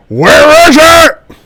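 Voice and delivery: drawn-out, high-pitched roar; slow, very loud in-character yell; interrogator's furious shout. Acted. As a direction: scream the demand at a captive. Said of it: "you hear him saying stuff like".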